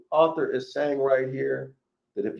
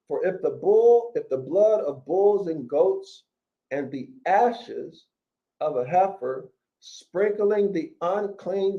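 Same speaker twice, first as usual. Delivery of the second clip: scripture reading; 4 semitones higher than usual. Acted. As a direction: proclaim each phrase like a preacher at the pulpit. Rereads verse, raised, slow.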